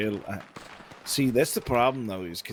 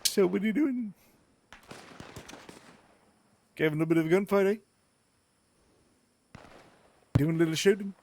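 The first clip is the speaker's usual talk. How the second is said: high, hammed-up New Zealand accent; a sing-song Kiwi put-on voice; copying the friend's demo in a high mock New Zealand accent; 9 semitones higher than usual. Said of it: "New Zealand kinda more like".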